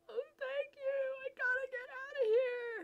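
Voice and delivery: very high, loud, tearful; desperate relieved crying. Acted. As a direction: cry out in relief to be rescued.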